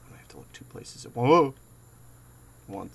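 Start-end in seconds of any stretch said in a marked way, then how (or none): 0.1-1.1 whispering. Real, not acted. Frightened whisper.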